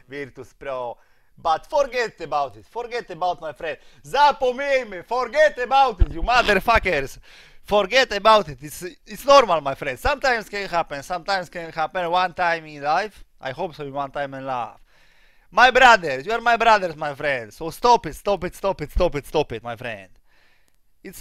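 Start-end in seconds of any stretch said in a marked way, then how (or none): 4.1-9.6 loud, high, insistent outburst; exasperated shout. Real, not acted. Streamer tells fans to forget the defeat, exasperated shout. 15.5-17.0 loud, high-pitched call; emphatic appeal. Real, not acted. Streamer appeals to his fans as brothers, loud emphatic call.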